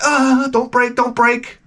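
high, strained pleading voice; mock pleading. Mock pleading with a guest not to break the glass. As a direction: beg it in a high whine. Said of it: "You're like".

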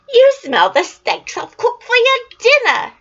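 high, loud sing-song wife; cheery giant's wife. Acted. Wife distracting the giant from Jack, high and loud. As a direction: cover for him brightly.

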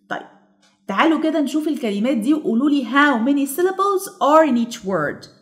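ordinary talk

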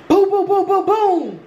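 high-pitched rhythmic booms; imitated door pounding. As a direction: pound it out vocally, high and drawn out.

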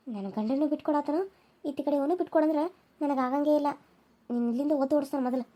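soft, bitter muttered aside to herself; jealous aside. Acted. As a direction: grumble it under your breath so they can't hear. Jealous sister muttering aside, unheard by others.

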